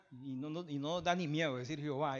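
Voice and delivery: usual speaking voice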